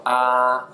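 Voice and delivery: AH held long on a level pitch; dentist tone. Shown as a demonstration. Teacher showing Mandarin first tone, flat AH. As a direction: say AH drawn out and flat. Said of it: "I call it the dentist tone".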